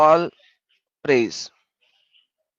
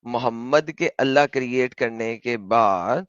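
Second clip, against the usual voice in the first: very slow, softer, each word drawn out; slow spelling out; 6 semitones lower, 5 dB quieter, much slower than usual. Shown as a demonstration. Teaching his friend, drawing each word out slowly. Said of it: "slow explanation".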